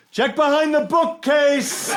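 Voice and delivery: high, slow mock yell. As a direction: shout out a tip to the Nazis. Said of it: "And I yelled".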